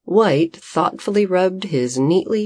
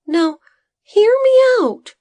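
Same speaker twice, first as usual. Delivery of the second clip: much higher, louder high protest; Bodo protesting; 7 dB louder than usual. A narrator's reading. Assistant raising paws to calm the farmer, loud high protest. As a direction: cut in and make him listen. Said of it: "his assistant protested".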